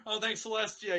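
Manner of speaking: ordinary talk, nothing marked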